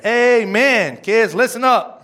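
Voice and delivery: loud, high rallying call. Real, not acted. Preacher calling on parents and kids in the house, loud call-out.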